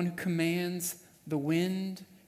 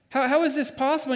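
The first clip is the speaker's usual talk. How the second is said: fast, high, louder incredulous question; angry disbelief; 8 semitones higher, 6 dB louder, faster than usual. Acted. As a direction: blurt it out, indignant and baffled. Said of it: "is anger".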